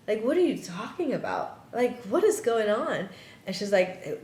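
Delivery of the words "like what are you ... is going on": retold bewildered questioning, higher pitch; baffled disbelief. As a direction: demand an explanation in high-pitched confusion.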